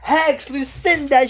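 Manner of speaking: high, drawn-out hex call; hammed-up sorceress calling out; villain's spell cry. Acting villain casting a hex.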